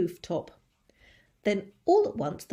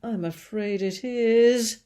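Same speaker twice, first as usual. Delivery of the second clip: grim police chief; 3 semitones higher, 7 dB louder, slower than usual. Acted. Police chief answering grimly.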